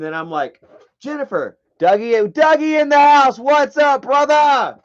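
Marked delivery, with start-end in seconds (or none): 1.0-1.6 retold name call. Calling a woman out of trance. 1.8-4.8 loud, high, hollered welcome; big excited shout-out; shouted greeting. Real, not acted. Hails someone who just arrived.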